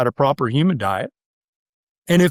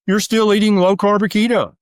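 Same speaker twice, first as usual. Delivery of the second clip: ordinary talk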